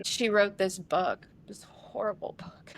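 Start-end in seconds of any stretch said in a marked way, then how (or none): 1.5-2.7 hushed whisper on the words. Real, not acted. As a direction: drop to a pained whisper. Painful memory of sister's book.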